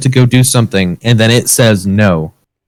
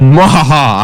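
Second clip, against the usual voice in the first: sneering panto villain cackle; slower than usual. Pretend. Mock villain after steering topic.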